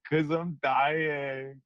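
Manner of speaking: very slow, dreamy drawl; blissfully happy. Retold or imitated. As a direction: imitate a blissed-out, spaced-out answer. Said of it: "Why are you so happy about it?".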